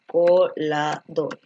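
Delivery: "Coladores" said very slowly, syllable by syllable, low. Shown as a demonstration. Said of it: "la forma correcta de colador". ("Coladores" dictado muy despacio)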